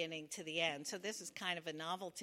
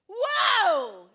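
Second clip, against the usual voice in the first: loud high whoop of delight; joyful scream; 11 semitones higher, 20 dB louder than usual. Retold or imitated. Woman overjoyed by the proposal, screaming.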